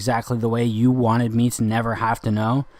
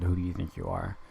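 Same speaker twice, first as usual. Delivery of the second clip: quiet challenge; 3 semitones lower, 9 dB quieter than usual. Retold or imitated. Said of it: "All of those things she heard".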